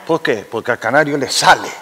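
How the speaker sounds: ordinary talk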